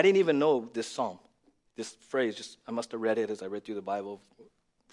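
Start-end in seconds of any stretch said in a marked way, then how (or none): none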